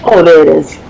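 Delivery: normal talking voice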